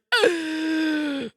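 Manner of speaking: a sharp high gasp of recognition; shocked gasp. Retold or imitated. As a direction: gasp like you just saw a celebrity. Recognizing Roy's badge.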